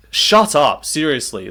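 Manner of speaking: ordinary talk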